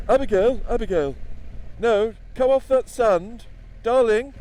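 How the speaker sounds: put-on high, loud plummy mum calling her child across the beach; posh mother calling. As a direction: call out like a posh seaside mum.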